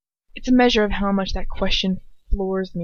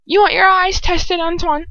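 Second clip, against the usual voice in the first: much higher, louder mocking jeer; taunting Bellatrix; 11 semitones higher, 7 dB louder than usual. Bellatrix jeering at Dolohov, high and loud. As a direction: sneer it up high at him. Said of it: "Bellatrix taunting".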